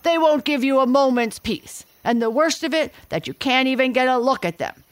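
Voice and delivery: loud, high, exasperated gorilla; irritated gorilla rant. A narrator's reading. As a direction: rant like a flea-bitten brute. Gorilla complains about fleas.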